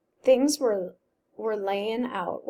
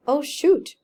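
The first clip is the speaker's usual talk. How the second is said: forced bright "oh, shoot", louder and higher; fake cheerfulness; 5 semitones higher, 7 dB louder than usual. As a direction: put on a cheerful front you don't feel. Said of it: "with fake cheerfulness".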